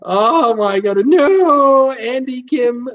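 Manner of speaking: high, loud burst through laughter; laughing. Real, not acted. Host laughing at a viewer teasing his buggy eyes, laughing loudly. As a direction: still giggling, can't get it out.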